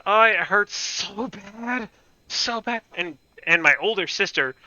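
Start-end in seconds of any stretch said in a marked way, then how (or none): 0.1-2.8 high, slow mock whimper of pain; hammed-up whine about the hurt foot; whiny self. Kid whining to mom about his foot, mock pained. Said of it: "And I'm like".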